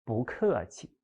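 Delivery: 不客气 rising on 不, falling on 客; 不 in second tone. Shown as a demonstration. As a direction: say 不客气 with 不 lifted, 客 dropped, 气 light. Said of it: "不 here is also the second tone".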